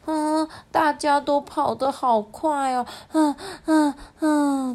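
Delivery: acted panting in a high voice; slow, high, worn-out puffing from running behind; out of breath. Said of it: "他气喘吁吁".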